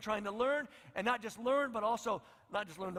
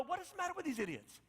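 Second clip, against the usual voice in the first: exasperated mock; 8 semitones higher, 4 dB quieter than usual. Readers exasperated.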